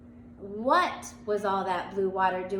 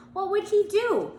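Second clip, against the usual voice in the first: high, louder teacher voice; teacher's question; 11 semitones higher, 5 dB louder than usual. A narrator's reading. Teacher asking the class, higher and louder. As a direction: voice the teacher, pitched up.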